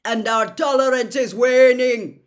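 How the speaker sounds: loud, cold centaur threat; menacing warning. A narrator's reading. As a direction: make the warning land.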